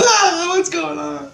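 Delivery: high, drawn-out mock wail; re-enacted helpless squeal; retold panic. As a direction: cry out slowly in high alarm. Acting himself carried off down street.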